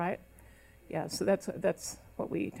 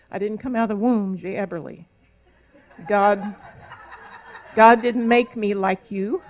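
firm retort; 7 dB louder than usual. Retold or imitated. Telling her husband off firmly.

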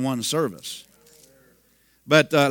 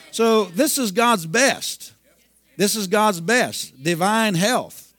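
slow, deliberate and higher; slow emphatic preaching; 4 semitones higher, slower than usual. Real, not acted. Preacher stresses God's best to the congregation, slow and deliberate. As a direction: lay every word down slowly. Preacher drives home the point.

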